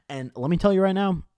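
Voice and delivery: ordinary delivery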